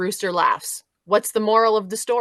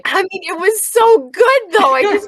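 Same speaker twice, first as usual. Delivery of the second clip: laughing; 12 semitones higher, 8 dB louder than usual. Real, not acted. Guest laughing about the joke.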